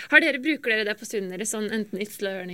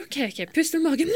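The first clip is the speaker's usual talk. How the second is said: higher imitated dad, soothing and steady; calm her down with a soothing voice; 7 semitones higher than usual. Dad on the phone calming his crying daughter, soothing.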